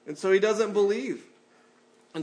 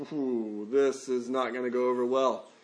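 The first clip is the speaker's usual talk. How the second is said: dreading groan; 6 semitones lower than usual. Pretend. Dreading delivering the boss's message.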